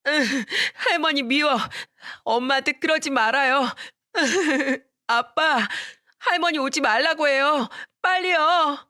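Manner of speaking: put-on a panicked little kid fighting off grandma; boy cries to his father for help, frantic high child voice; boy in a tantrum. Boy hitting grandmother, calling dad.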